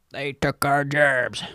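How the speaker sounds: mock the South Park line, slow and dopey; South Park quote. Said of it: "shout out South Park".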